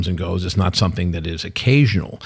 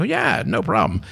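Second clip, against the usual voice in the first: high and slow, casual confidence; answering the company that asked for a second plan; breezy yes; 9 semitones higher, 4 dB quieter, slower than usual. Retold or imitated. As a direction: drawl it up high like it's nothing. Retold agreeing to write plan.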